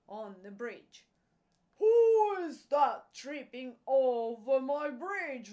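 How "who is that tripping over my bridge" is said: very loud storybook roar; troll's roar. A narrator's reading. Troll challenging the middle goat, big loud roar. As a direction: roar it out as the troll under the bridge. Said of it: "roared the troll".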